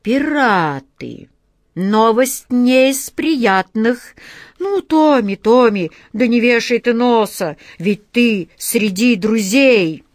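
hearty comforting. Acted. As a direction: buck the boy up heartily. Captain cheers up sad Tommy.

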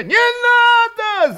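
very high, drawn-out yell of protest; crowd shout. A narrator's reading. Many Cossacks reject wintering away, shouting. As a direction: holler a long refusal. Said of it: "закричали многие".

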